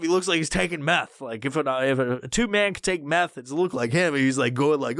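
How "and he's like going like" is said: ordinary talk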